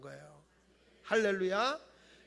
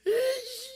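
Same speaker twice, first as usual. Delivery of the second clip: a hammed-up high child's sob; mock child sobbing; 12 semitones higher, 4 dB louder, much slower than usual. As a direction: imitate a kid crying outside. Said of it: "바깥에 서가지고 우는 거예요". (아이 울음 흉내, 과장되게)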